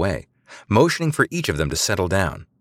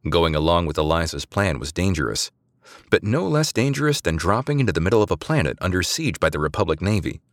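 ordinary delivery, nothing marked

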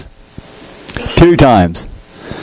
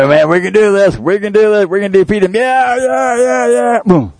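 mock halftime rallying, climbing ever higher; imitated coach pep talk; 9 semitones higher than usual. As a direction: pump up the team like a coach, pitch rising. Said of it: "And the coach said".

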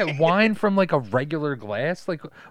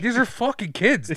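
retold exclamation; 11 semitones higher, 7 dB louder than usual. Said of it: "he's like".

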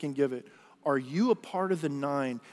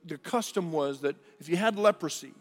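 usual speaking voice

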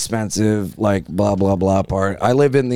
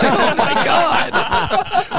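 very slow, high-pitched laughing gasp; laughing disbelief; much slower than usual. Retold or imitated. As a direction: drag it out through laughter. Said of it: "I was like".

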